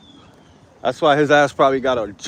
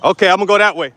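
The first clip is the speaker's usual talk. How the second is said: higher and louder, called out so others hear; loud announcement; 7 semitones higher, 5 dB louder, much faster than usual. Real, not acted. Vlogger calls out his route to the people around him, higher and louder. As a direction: announce it loudly to the street. Announcing the direction aloud to passersby.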